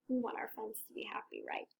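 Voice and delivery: gentle whisper; soft aside. Real, not acted. Reader telling children about friends, soft whisper. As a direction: confide in the listeners. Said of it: "aside to children".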